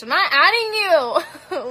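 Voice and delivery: high, loud refusal broken by laughter; loud laughing no to a cheeky friend; laughing yell. Real, not acted. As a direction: yell it through real laughter.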